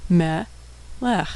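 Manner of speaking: syllable by syllable. Shown as a demonstration. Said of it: "and final kaf with a shva".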